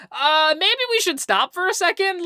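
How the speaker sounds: high-pitched tentative suggestion; hesitant bystander. Acted. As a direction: timidly float an obvious idea, mockingly. Voicing what no onlooker thinks.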